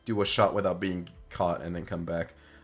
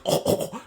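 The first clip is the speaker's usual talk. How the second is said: voiceless mock choking gasps; fake choking; 4 dB louder than usual. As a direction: act out choking on a stuffed sock, overdone. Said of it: "you begin trying to fake choke".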